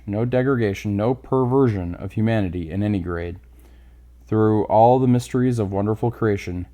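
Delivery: usual speaking voice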